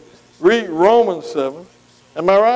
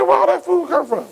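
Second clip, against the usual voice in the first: retold surprise; 7 semitones higher than usual. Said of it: "I said".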